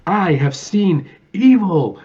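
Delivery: slow, high, booming dramatic pronouncement; hammed-up Gomez Addams gravitas; grand Raul Julia. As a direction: intone it like a tragic stage actor. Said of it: "he's like".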